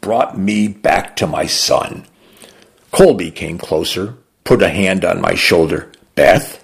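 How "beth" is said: ordinary delivery, nothing marked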